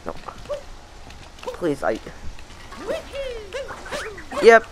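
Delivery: ordinary talk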